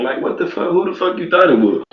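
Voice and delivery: a shocked, high-pitched what the hell; incredulous disbelief. Real, not acted. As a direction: pitch jumps way up, stunned and disbelieving. Shocked reaction to the quote.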